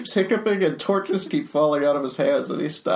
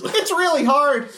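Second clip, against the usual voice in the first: high character voice; 11 semitones higher, 4 dB louder than usual. Acted. S0 voicing Modron character.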